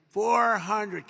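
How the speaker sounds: loud spaced altitude callout; computer voice callout. Retold or imitated. Cockpit computer counting down the altitude, loud callout. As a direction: call the numbers like the plane's computer. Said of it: "you have the computer voice".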